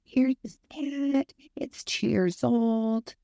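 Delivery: slow, high, put-on sweet shelter-worker voice. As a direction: play the syrupy animal shelter clerk, slowly. Shelter staff voiced in story.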